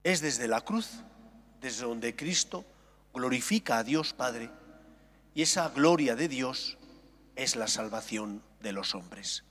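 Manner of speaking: normal talking voice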